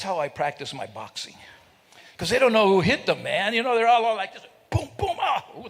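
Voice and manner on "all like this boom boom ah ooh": mock punch noises. Acting out elbowing in aisle.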